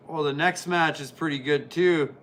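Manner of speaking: usual speaking voice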